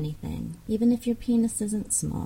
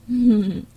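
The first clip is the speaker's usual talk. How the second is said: mocking laugh; 4 semitones higher, 7 dB louder than usual. Mocking listener's small size.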